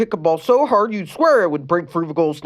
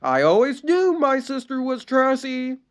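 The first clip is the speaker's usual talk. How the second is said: shouted; 11 semitones higher than usual. A narrator's reading. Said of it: "shouted towards the audience".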